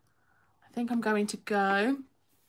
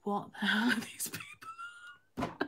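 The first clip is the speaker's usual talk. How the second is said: imitating a puzzled husband in a hushed whisper; mock whisper at the dinner table; baffled whisper; 7 dB quieter than usual. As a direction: breathe it out, bewildered, no voice. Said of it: "And Chris was just like".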